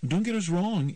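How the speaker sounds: put-on high teen voice, hastening to qualify; lightly voiced teen girl. Ema softens their account for Myron, lightly voiced teen. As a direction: lightly play a teenager clarifying. Ema's dialogue.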